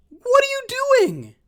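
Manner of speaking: real outraged shout, drawn out slow; exasperated yell. Real, not acted. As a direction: wail it at the coordinator, fed up. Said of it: "So it turns out I am mad".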